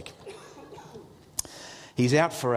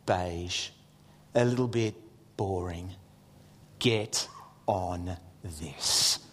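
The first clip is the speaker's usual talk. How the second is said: low, softer mock ad voice; mock advert; 10 semitones lower, 6 dB quieter, slower than usual. Preacher pitching a manga Bible to the congregation, mock ad voice. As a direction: drop low like a tongue-in-cheek commercial.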